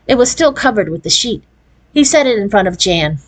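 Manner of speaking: plain reading voice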